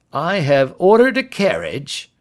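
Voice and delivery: put-on police inspector voice; Lestrade. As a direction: announce it as a self-assured inspector.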